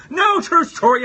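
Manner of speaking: loud, high objection; mock indignant protest. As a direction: protest loudly in playful outrage. Protesting own sperm remark.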